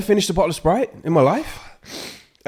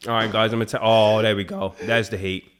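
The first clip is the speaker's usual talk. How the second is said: lower, strained after hot chip; talking through a burning mouth; 7 semitones lower, 4 dB quieter than usual. Real, not acted. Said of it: "That bad?".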